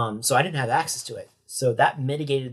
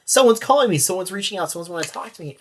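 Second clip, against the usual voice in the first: higher, louder burst of excitement, easing off; excited relief; 6 semitones higher, 6 dB louder than usual. Retold or imitated. Retelling his joy that someone finally called, excited. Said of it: "I just felt so excited".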